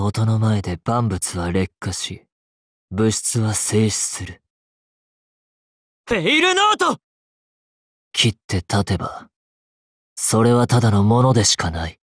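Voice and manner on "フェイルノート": very high, ringing battle cry; attack cry. Acted. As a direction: call out the move at the top of your range. Calls out the attack name. (甲高い必殺技の叫び)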